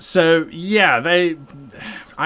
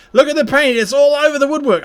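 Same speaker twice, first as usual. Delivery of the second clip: higher and louder, half-laughing dismay; excited exclaim; 11 semitones higher, 8 dB louder, faster than usual. Real, not acted. Painter spotting paint on the woodwork, exclaims in loud mock horror. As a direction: cry out at the paint spill.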